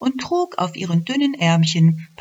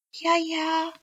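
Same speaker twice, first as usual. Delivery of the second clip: resigned elf; 12 semitones higher, 3 dB quieter than usual. Acted. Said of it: "meinte die Elfe und ließ den Kopf hängen".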